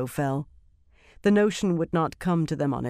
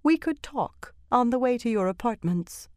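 high, lovely voice without special weight; casual, high voice; 8 semitones higher than usual. A narrator's reading. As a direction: suggest it lightly, pitched high. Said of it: "her tone put no special freight on the words".

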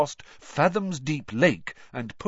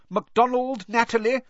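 calling out name; 13 semitones higher, 5 dB louder than usual. Acted. Name called out.

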